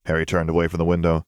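plain reading voice

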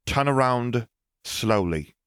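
high-pitched command, dropping on the last word; firm, measured order. A narrator's reading. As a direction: order them to turn, in a high female-character voice. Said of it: "Pansy ordered".

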